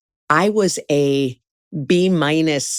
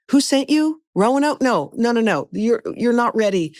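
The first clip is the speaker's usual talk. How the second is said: imitating an incredulous boss, pitched up; higher-pitched retold brush-off; mock dismissive; 6 semitones higher than usual. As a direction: wave the applicant away in disbelief. Third director rejects her, retold.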